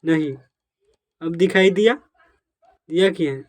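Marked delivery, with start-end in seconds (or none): none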